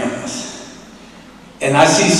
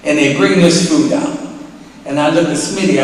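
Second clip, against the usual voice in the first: ordinary talk, nothing marked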